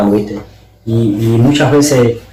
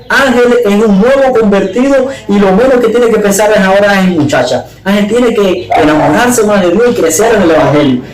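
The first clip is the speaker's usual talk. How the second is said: higher-pitched voice for a quoted critic; emphatic retold complaint; 8 semitones higher than usual. Someone tells the pastor about the new convert, emphatic and high. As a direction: retell their verdict on him, pitched up.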